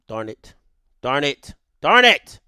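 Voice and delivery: muttered annoyance building in pitch and volume; real frustration. Real, not acted. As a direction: repeat it to yourself, each one stronger. Loses his work on screen.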